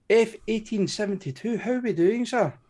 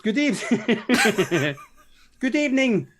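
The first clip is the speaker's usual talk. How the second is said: laughing. Real, not acted. Laughs through a repeated greeting.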